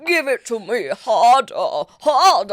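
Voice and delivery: loud, hammed-up British old actor; mock-seductive British thespian; sexy Ian McKellen. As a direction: demand it like a lusty old stage actor. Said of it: "a sexy Ian McKellen accent".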